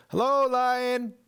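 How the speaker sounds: put-on boy greeting a lion with a high, loud hello; bright boy voice. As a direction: chirp it like an eager kid.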